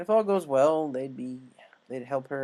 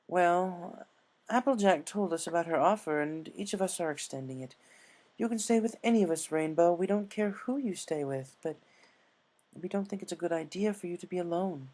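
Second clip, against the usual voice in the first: higher, earnest refined voice; Rarity insisting; 5 semitones higher than usual. Acted. Rarity urges Rainbow not to be alone. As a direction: press the offer kindly. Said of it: "Rarity said".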